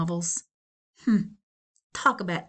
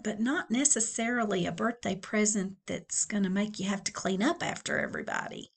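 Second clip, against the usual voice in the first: plain reading voice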